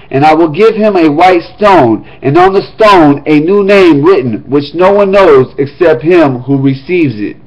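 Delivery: ordinary talk, nothing marked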